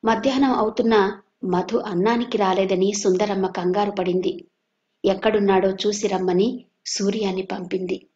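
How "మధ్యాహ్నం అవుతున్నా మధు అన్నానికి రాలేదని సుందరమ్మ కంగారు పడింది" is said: usual speaking voice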